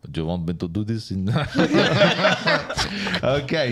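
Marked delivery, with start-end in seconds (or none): 1.3-3.2 loud high laughter breaking out; real laughter before reading the script; burst of laughter. Real, not acted. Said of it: "Who's editing this podcast?".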